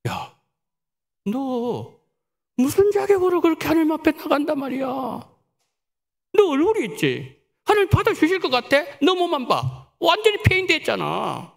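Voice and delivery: sneering tempter voice, fast and mocking; taunting devil. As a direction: taunt her like a jeering devil. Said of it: "마귀가 이렇게 유혹하는 거예요".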